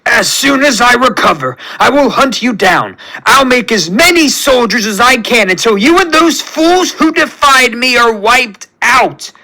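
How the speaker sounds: high, vengeful villain rant; High Evolutionary screaming. A narrator's reading. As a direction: scream threats at the one who beat you. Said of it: "the High Evolutionary screams".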